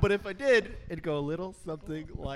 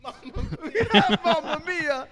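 high, loud, slow mamma mia; hammed-up Italian chef cry; Italian accent; 10 semitones higher, 8 dB louder, slower than usual. Cartoon Italian exclamation.

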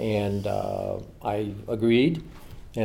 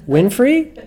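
high, loud startled question; incredulous; 13 semitones higher, 8 dB louder than usual. Retold or imitated. Retelling the phone call to Jackie, high startled disbelief. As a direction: squeak out the name in disbelief.

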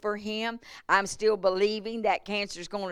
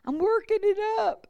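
much higher, sheepish admission; sheepish confession; 13 semitones higher, 3 dB louder than usual. Retold or imitated. Preacher retelling her sheepish answer to God, much higher. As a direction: admit it high and a little embarrassed.

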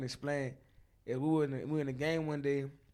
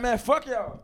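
loud, high imitated brush-off; retold angry dismissal; dismissive yell; 9 semitones higher, 9 dB louder, slower than usual. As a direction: curse them off as the fed-up coach. Retells coach cursing players off.

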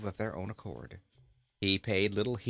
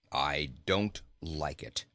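low, grumpy goat voice; surly low-pitched goat; complaining goat; 6 semitones lower than usual. A narrator's reading. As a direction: complain in a deep, sour tone. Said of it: "complained the goat".

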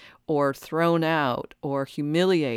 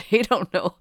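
laughing; 4 semitones higher, 4 dB louder than usual. Real, not acted. Laughs at people's ignorance.

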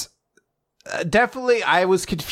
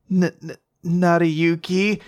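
slow, shy stammering on the name; nervous stammer; 4 semitones higher, slower than usual. Retold or imitated. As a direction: stumble over his name, blushing. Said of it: "so, like, blushing and nervous as she says it".

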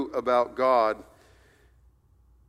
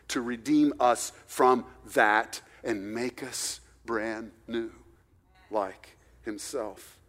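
much softer, hushed earnest preaching; intimate preaching; 4 semitones lower, 4 dB quieter than usual. Acted. Pastor to the congregation, hushed and earnest. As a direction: draw them in with a low, soft plea. Quiet intense climax of sermon.

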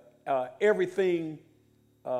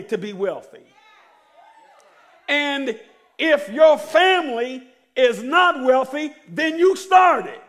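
preaching; 9 semitones higher, 9 dB louder, slower than usual. Acted. Preacher rising, louder.

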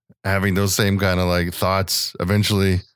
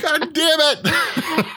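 high, long-drawn groaning curse through laughter; mock despair, breaking into laughs; laughing exasperation; much slower than usual. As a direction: drag it out in squeaky regret. Exasperated at own blunder, laughing.

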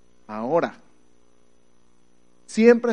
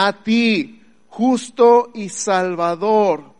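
loud, slow, higher preaching proclamation; proclaiming scripture; 4 semitones higher, 5 dB louder, much slower than usual. Acted. Preacher proclaiming the prophecy to the church, slow and loud. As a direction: declaim the prophecy slowly.

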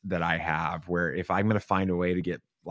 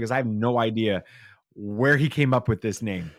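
ordinary talk, nothing marked

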